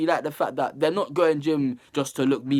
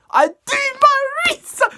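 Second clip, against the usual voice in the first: "I do my research" squeaked very high and loud; playful squeaky high boast; mock boast; 8 dB louder than usual. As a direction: brag it in a silly high voice.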